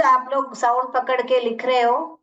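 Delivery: ordinary delivery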